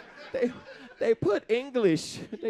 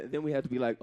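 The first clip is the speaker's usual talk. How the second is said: ordinary talk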